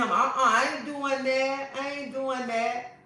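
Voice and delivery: slow, laughing mock of her saying no; laughing, slow imitation of a wife refusing her man; mock prissy refusal. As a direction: drag it out, teasing the prim wife. Mocking the churchgoing wife's refusal.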